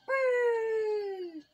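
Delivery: long, high whee; playful high squeal. Pretend. Player sending someone flying, playful high whee. Flinging about in the game.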